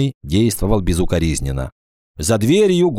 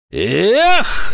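scornful exclamation; 13 semitones higher, 4 dB louder than usual. A narrator's reading. Презрительный возглас Агафонова.

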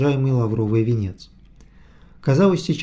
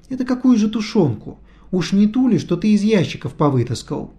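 indignant; 8 semitones higher than usual. A narrator's reading. Natasha confronting him, hands on hips.

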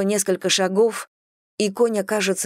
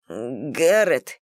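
lower, halting, stammered name; stammering; 4 semitones lower than usual. A narrator's reading. William asking after Garret, stammering. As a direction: stumble into the name. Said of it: "заикался Уильям".

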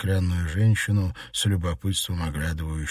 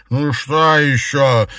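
loud, drawn-out, higher reply; irritated maid; 7 semitones higher, 8 dB louder, much slower than usual. A narrator's reading. Maid answering from the kitchen, irritated. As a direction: call back slowly, fed up at being summoned. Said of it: "тут же раздалось из кухни".

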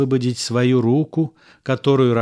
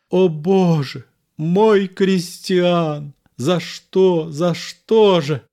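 higher, slow grieving cry; anguished lament; 6 semitones higher, slower than usual. A narrator's reading. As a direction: plead to heaven over the body.

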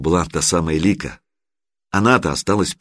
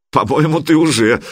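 usual speaking voice

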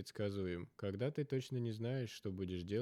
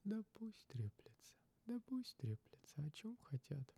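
high unvoiced whisper; hushed dismissive whisper; 11 semitones higher, 6 dB quieter than usual. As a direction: brush it off in a whisper.

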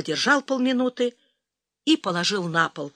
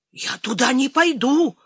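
higher-pitched, balking protest; obstinate, raised pitch; stubborn refusal; 5 semitones higher, 3 dB louder than usual. A narrator's reading. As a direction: dig your heels in. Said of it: "заупрямился ракетчик".

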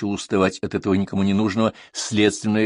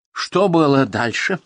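loud, slow, higher demand; impatient; 6 semitones higher, 5 dB louder, slower than usual. A narrator's reading. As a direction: press impatiently for what came next. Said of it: "Нетерпение этого господина О достигло своего предела". (нетерпеливо, с нажимом)

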